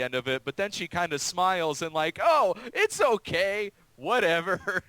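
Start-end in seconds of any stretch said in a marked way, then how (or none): 2.2-4.8 higher, slow, airy imitation of the character; lightly voiced dismissive woman; mock breezy shrug-off. Mimicking the character who smiles off harassment, higher and slow.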